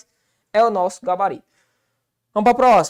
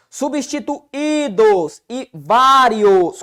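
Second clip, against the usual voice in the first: slow emphasis; 2 semitones higher, 6 dB louder, much slower than usual. Shown as a demonstration. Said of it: "Cuidado, cuidado".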